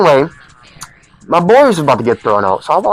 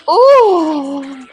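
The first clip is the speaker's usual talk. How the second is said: mock pained ooh; 10 semitones higher than usual. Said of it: "my baba was like".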